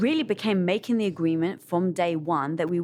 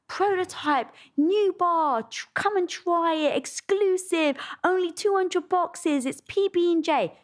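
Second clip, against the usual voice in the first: mock ad read; 10 semitones higher, slower than usual. Retelling the Facebook sales blast.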